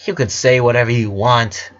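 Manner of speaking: ordinary delivery, nothing marked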